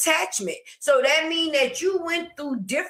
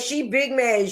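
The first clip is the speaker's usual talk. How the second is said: ordinary talk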